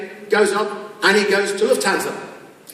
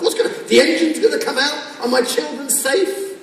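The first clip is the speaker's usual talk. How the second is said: high-pitched worried car owner; hammed-up anxious questioning; mock alarm; 7 semitones higher than usual. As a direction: fret aloud in playful panic. Voices worried owner of broken car.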